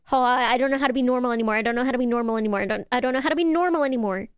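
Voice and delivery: very fast repeated babble; flustered babble. Real, not acted. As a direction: rattle it off, tripping over yourself. Flustered repetition after excited outburst.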